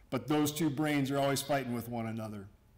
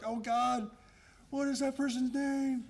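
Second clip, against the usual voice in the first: much higher, hammed-up internal panic; mock inner panic; 11 semitones higher than usual. His inner voice panics at forgetting a coworker's name, comically higher. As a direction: fret silently, racking your brain for a name.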